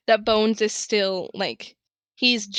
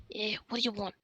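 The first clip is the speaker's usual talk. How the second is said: quiet suspicious alley contact; gruff quiet challenge; 12 dB quieter, faster than usual. Acted. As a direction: mutter it like a wary lookout. Informant challenges the player.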